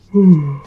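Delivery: a big loud weary sigh; loud tired sigh. Real, not acted. Late at night, telling the boys he's tired, heavy loud sigh. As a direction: let out a heavy tired exhale. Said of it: "I'm tired".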